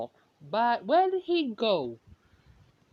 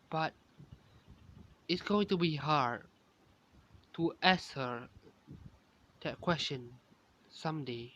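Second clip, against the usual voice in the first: softer, lower, fading shy confession; shy and blushing; 6 semitones lower, 6 dB quieter than usual. A narrator's reading. Kaito blushing alone about asking her someday, shy and soft. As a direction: murmur it bashfully, trailing away. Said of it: "blushing a little".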